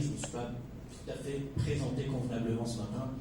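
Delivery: usual speaking voice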